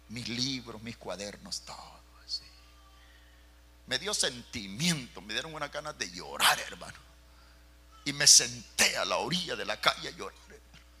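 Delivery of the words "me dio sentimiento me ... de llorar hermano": usual speaking voice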